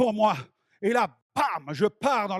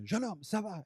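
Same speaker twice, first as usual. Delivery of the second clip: soft, still far away; distant voice; 9 dB quieter than usual. Retold or imitated. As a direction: call softly through a haze. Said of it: "J'entends une voix au loin".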